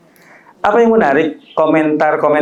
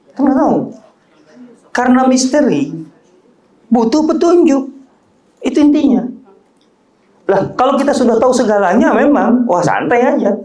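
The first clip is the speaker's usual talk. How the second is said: raised, urgent sermon voice; emphatic preaching; 10 semitones higher than usual. Acted. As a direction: drive the point home to the congregation. (khotbah yang berapi-api)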